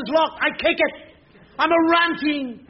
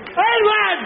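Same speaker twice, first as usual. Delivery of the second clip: high raised calls over the bed; calling his name; 7 semitones higher, much slower than usual. Retold or imitated. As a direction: try to wake him by calling out. Dad shouting over the bed.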